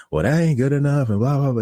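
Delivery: high, mocking retold rant; imitating customer going off. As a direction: mimic her protest, tumbling into blah-blah. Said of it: "she's just going off on me".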